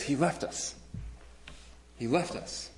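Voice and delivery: a hint of a scared girl begging; mild panicked plea. Girl in the movie clinging to the hero, lightly panicked. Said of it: "she's just freaking out".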